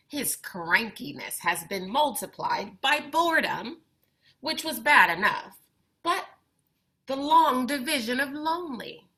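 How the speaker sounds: put-on expressive read-aloud for kids, lively and clear; picture book reading. A narrator's reading. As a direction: bring the story to life for young listeners. Reads picture book to children.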